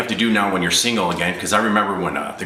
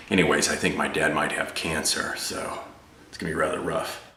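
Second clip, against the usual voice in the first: resume casual guy talk, trailing off softer; 3 semitones lower, 7 dB quieter than usual. Acted. One guy telling his friend about his dad, low and casual. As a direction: drop back to low, subdued chat. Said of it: "impression of two guys talking".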